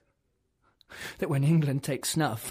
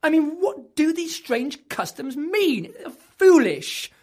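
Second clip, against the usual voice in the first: indignant rant; 8 semitones higher, 6 dB louder than usual. Acted. Furious rant at Spanish customs.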